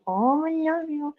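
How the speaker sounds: high sing-song love coo for the pet. Real, not acted. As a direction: croon it high to the cat. Cooing to the cat.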